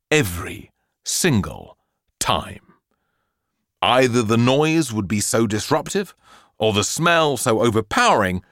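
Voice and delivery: ordinary delivery, nothing marked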